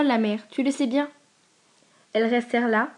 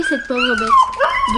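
wailing like a howling dog; 7 dB louder, much slower than usual. A narrator's reading. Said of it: "Elle hurlait".

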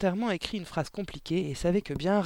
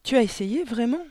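higher, louder question of surprise; astonished; 7 semitones higher, 7 dB louder than usual. A narrator's reading. Miss Candy to Mathilda about her quatrains, astonished. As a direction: ask it in amazement, pitch lifted. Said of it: "s'étonna mademoiselle Candy, plus éberluée que jamais".

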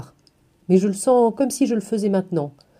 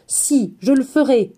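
higher, louder, slow defiance; defiant outburst; 5 semitones higher, 4 dB louder, slower than usual. A narrator's reading. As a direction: fling the words out against her. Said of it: "projette violemment hors de moi les mots". (enfant qui réplique avec défi, plus haut et plus fort)

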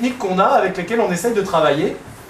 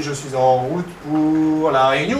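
slow, stretched-out syllables; slowed-down speech; 2 semitones lower, slower than usual. Shown as a demonstration. Researcher demonstrating a change of speed, very slow and drawn out. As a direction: drag the words out like slowed playback. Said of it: "On peut changer la vitesse aussi".

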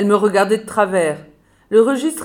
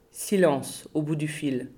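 soft, slow ominous narration; narrating an eerie phone silence; hushed; 4 semitones lower, 7 dB quieter, slower than usual. A narrator's reading. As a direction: let the silence sink in.

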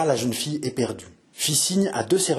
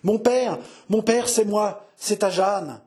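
high, urgent appeal to a father; anguished daughter, understated sobbing; distraught plea; 8 semitones higher, slower than usual. A narrator's reading. Daughter clasping her father's head, distraught plea. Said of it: "toute secouée de sanglots".

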